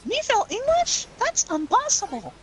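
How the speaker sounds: very high, childlike cartoon voice; squeaky and proudly clueless; Ralph Wiggum quote. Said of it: "I told that to my friend".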